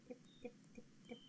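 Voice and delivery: soft unvoiced tip-tip for tiny feet; a whispered pitter-patter; whispered footsteps. Shown as a demonstration. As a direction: breathe the tips like little footsteps. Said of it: "You can hear his little feet".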